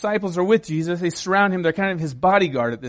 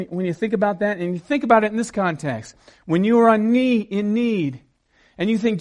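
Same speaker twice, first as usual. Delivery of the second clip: ordinary talk, nothing marked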